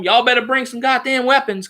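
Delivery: ordinary talk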